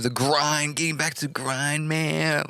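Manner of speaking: high, put-on grind-bragging voice; mock hustle talk. Host goofing on people getting back to the grind, mocking high voice. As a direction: send up the grind crowd, pitched high.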